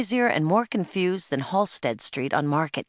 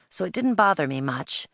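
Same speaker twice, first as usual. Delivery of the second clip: usual speaking voice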